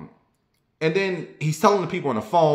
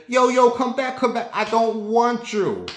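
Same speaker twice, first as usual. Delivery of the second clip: high calling out; 10 semitones higher than usual. Retold or imitated. Stranger calling after teller.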